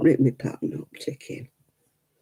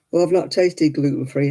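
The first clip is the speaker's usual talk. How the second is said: usual speaking voice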